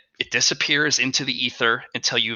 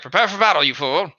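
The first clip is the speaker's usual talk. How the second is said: paranoid creature voice; 3 semitones higher, 4 dB louder than usual. Acted. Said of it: "But it's like".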